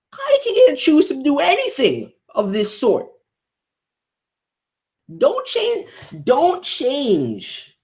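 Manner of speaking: high and loud, pleading in protest; pleading angry yell. Real, not acted. Defending Kaneki to the comic, a pleading yell. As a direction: shout it like you're begging them to see.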